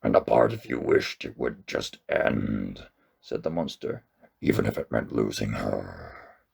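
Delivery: deep, calm ancient monster; a gentle giant speaking low. A narrator's reading.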